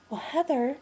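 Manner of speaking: high, sing-song mock of the mother-in-law; mimicking mother-in-law. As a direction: lift the voice into her airy greeting. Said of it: "she goes".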